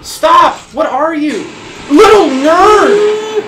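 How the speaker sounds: loud, high outburst at the monster; exasperated yelling. Real, not acted. Player chased after taunting the monster, exasperated yelling. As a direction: yell insults in alarm, stretching the last words.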